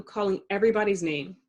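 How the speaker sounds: ordinary delivery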